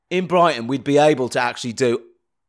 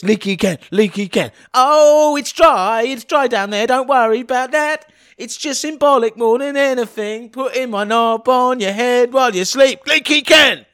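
Leaky Ken voice; 10 semitones higher, 4 dB louder than usual. Pretend. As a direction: croon it like a creepy night visitor. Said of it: "They could do this".

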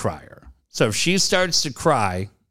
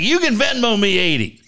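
heated; 8 semitones higher than usual. Retold or imitated. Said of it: "man, I'm pretty heated".

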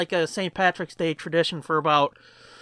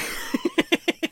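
airy laugh; 9 semitones higher, 3 dB quieter than usual. Real, not acted. Laughs after sign-off.

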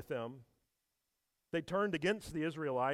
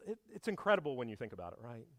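ordinary talk, nothing marked

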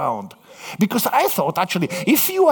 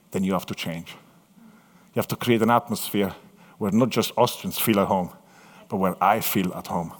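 low, soft, earnest voice of God; softly voiced God; God speaking; 7 semitones lower, 3 dB quieter than usual. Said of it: "He said".

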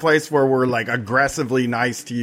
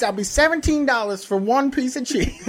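much higher hammed-up country mama, laughing through it; Southern mama; 13 semitones higher than usual. Barefoot host calls folks in to eat, high laughing mock mama. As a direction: lay on the hillbilly mama voice, ringing the bell.

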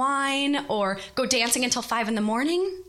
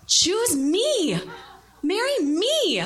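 high, swooping mock plea; overdone lovelorn pleading; melodramatic plea; 7 semitones higher, much slower than usual. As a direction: beg like a hammed-up romcom heroine. Imagined desperate plea before the ceremony.

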